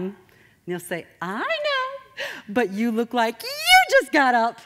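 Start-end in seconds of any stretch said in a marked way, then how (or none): 1.2-4.6 squealing high neighbor voice, drawn out; exaggerated high, loud teasing; Bob. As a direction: mimic an irritating neighbor, shooting up into falsetto. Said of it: "That's how he talks".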